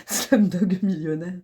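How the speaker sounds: Slumdog Millionnaire dit lentement avec un accent anglais appuyé. Shown as a demonstration. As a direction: prononcer le titre à l'anglaise, en prenant son temps. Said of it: "mon accent". (léger accent anglais, ralenti)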